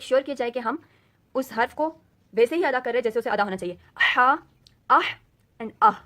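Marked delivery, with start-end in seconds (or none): 4.2-6.0 ہا and آہ breathed from different depths of the throat; throat letter drills. Shown as a demonstration. Teacher contrasting throat letters for students, loud and clear. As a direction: contrast each throat sound. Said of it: "ان میں differentiate کر سکیں".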